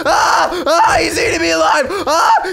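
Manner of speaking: hammed-up terror, high and loud; half-laughing mock panic as a dino drags him off; panicked yelling. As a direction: scream like the dinosaur is eating you. Streamer mock-panics as dinosaur grabs him.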